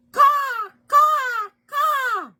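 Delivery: loud, very high caws; crow cawing. Acted. Storybook crow calling out, loud cawing. Imitates the crow's call.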